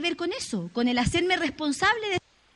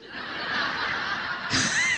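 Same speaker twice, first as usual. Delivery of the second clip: laugh. Real, not acted. Crowd laughs at the shock line.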